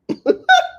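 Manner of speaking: high-pitched short cackle; laugh. Real, not acted. Host amused after his own accent act, high laugh. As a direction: break into a high giggle.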